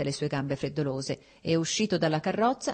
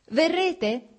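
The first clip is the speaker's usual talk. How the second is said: high, loud call after him; 12 semitones higher, 5 dB louder than usual. A narrator's reading. Dolly calling after Alexei as he leaves, loud and high. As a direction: shout the question across the street. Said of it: "gridò Dolly".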